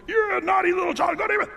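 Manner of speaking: put-on high, loud, far-off shrill yell at the child; hammed-up angry parent shouting; emotional scolding. Parent yelling at a naughty child, hammed-up high scolding. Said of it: "It wasn't".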